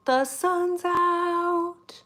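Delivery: high, very slow sun's out; dreamy delight. Real, not acted. Showing viewers the sun, drawn out and high. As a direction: draw it out blissfully.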